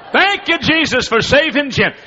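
high, loud, overjoyed cries of thanks; wife rejoicing out loud that her husband got saved; joyful praise. Retold or imitated. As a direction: shout your thanks like your prayer was just answered. Wife hugging the preacher.

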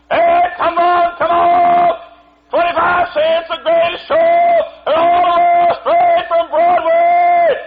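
high, loud, slow barker's shout; carnival barker. Retold or imitated. Fairground barker calling the crowd to the show, loud and high. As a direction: bark it out to pull in the crowd. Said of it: "this fella up there barking off".

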